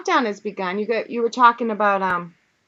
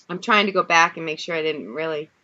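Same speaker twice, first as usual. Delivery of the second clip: normal talking voice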